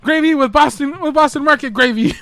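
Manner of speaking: high, loud Cartman voice, accusing; shrill cartoon kid catching someone cheating; Cartman impression. Cartman accusing a dealer over KFC gravy, high and loud. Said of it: "He's like".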